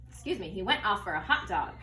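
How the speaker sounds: ordinary talk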